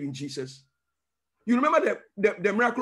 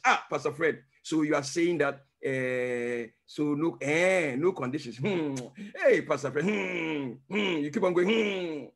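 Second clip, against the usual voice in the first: doubting listener; 5 semitones lower than usual. Retold or imitated.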